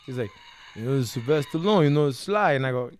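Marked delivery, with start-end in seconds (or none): 0.8-2.5 louder, quick Rocky impression; hurried movie-boxer voice; Sylvester Stallone. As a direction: imitate the action star insisting who he is. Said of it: "Make sure you do his voice".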